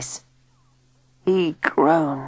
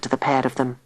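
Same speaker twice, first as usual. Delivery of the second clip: plain reading voice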